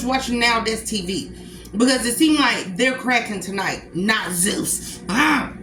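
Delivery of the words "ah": usual speaking voice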